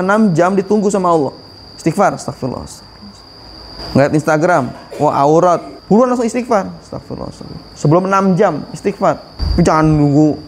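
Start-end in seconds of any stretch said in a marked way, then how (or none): 2.7-3.2 astagfirullah fully whispered under the breath. Shown as a demonstration. Demonstrating quick istighfar. 6.8-7.8 astagfirullah, astagfirullah whispered quickly twice. Shown as a demonstration. Demonstrating istighfar at sinful sight.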